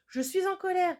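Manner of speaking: put-on mother warning her kids, angry. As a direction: say it as a scolding mum who has had enough. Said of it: "je me mets en colère".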